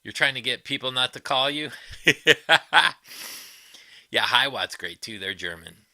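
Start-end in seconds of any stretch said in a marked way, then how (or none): 1.8-3.4 a big real burst of laughter; loud laugh. Real, not acted. Reacting to a funny comment.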